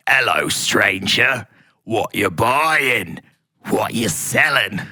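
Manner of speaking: slow, low, shady trader; Resident Evil merchant. Acted. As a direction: drag it out low like a sinister game vendor. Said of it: "obsessed with how he speaks".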